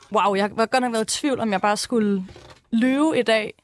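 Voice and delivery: normal talking voice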